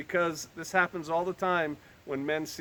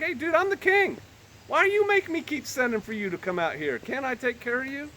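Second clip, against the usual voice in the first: mock exasperated king; 6 semitones higher than usual. King paraphrased as casual.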